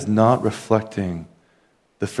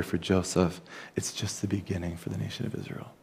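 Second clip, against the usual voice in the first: awed whisper; 2 semitones lower, 10 dB quieter than usual. Real, not acted. Awed closing reflection on story.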